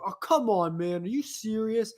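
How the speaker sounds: exasperated stranger in the restroom; imitating a fed-up man; annoyed guy. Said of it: "I hear a guy go".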